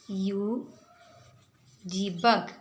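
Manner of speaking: ordinary talk, nothing marked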